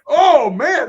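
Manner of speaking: shocked. Retold or imitated. Mike sees the clogged toilet.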